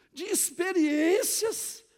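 loud, slow, drawn-out preaching; emphatic proclamation. Real, not acted. Pastor answering his own question to the congregation, loud emphatic proclamation. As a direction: raise the voice and stretch "experiências".

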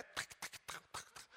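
unvoiced clicking of heels on the floor; high heels clicking. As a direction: tap out heel steps with the tongue. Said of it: "porque o salto altíssimo".

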